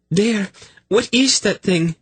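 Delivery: a frightened woman spots something in the dark; shrill alarm. Acted. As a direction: shriek it out in sudden alarm. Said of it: "Sinedra's voice was shrill".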